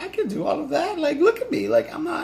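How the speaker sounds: very high, squeaky self-quote; high girly voice. Retold or imitated. Answering her boyfriend in a very high squeaky voice. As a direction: squeak it like an unsure girl.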